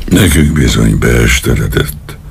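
ordinary delivery

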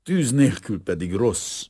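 lightly acted confusion, apologising to a guest; embarrassed, flustered. A narrator's reading. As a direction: excuse yourself in an embarrassed fluster. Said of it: "motyogta a professzor zavartan".

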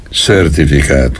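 usual speaking voice

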